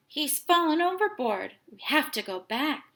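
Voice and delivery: alarmed father pleading; father realising the dog fell in, alarmed. A narrator's reading. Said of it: "Tim's dad said".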